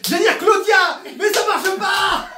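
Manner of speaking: high, loud and slow laughing protest; loud comic frustration; loud dismay. Real, not acted. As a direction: cry out at your own mistake. Laughs at wrong word.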